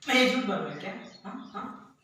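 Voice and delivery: loud, confrontational question; heated, shouted demand; angry challenge. Acted. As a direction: throw the accusation back at full voice. Repeats challenge louder.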